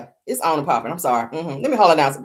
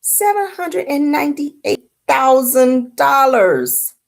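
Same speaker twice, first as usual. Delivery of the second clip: each word drawn out slowly, higher; slow, stunned repeat; 6 semitones higher, much slower than usual. Shown as a demonstration. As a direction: let the number sink in, slow and amazed. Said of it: "repeats the figure slowly".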